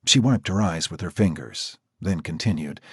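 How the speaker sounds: plain reading voice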